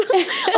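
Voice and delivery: short high giggle; real amused giggle. Real, not acted. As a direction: let a high giggle slip out. Laugh alone on a line.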